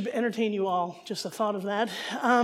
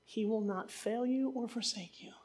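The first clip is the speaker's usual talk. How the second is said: breathy hushed promise; whispered scripture; 8 dB quieter than usual. Acted. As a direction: whisper it like a comfort.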